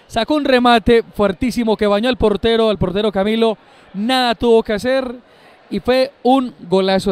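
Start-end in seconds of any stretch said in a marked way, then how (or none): none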